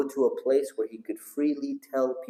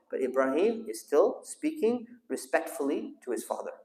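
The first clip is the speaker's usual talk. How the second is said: ordinary talk, nothing marked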